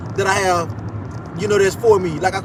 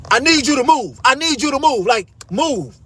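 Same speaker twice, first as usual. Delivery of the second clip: higher, pushy repeat of the order; mimicking bossy demand; 6 semitones higher than usual. Retelling the guard ordering him to move, pushy and higher. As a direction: imitate her insisting he move. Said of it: "This, this is her exact words".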